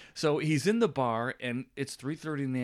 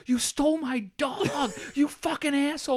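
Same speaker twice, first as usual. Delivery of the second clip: mock angry woman; 4 dB louder than usual. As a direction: squeal it like an outraged woman. Angry woman confronting him at bar.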